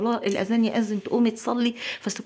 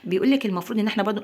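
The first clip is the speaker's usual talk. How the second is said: usual speaking voice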